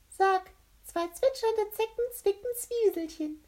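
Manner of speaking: very high, loud character voice; squeaky captain voice. A narrator's reading. Captain K challenges the mouth, high and squeaky. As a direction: pipe out the tongue twister in a squeak. Captain sets a tongue twister.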